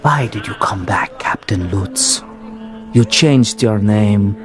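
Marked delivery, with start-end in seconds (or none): 0.0-2.2 whisper. Acted. Ghost whispers accusing question.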